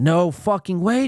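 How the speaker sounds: high, loud disbelief; shocked outburst. Real, not acted. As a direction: blurt out the shock. Shock at a game call.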